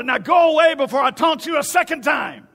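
loud, high, hammed-up holler; mock screamed taunt. Goliath sending the army away with a movie line, mock screaming. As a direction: shout them away with a mock threat. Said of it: "he would scream and holler".

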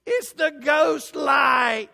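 high, very slow, loud cartoon shout; a drawn-out, high hillbilly holler; Mater from Cars. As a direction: yell it like a goofy cartoon tow truck. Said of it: "the short called Ghost Light".

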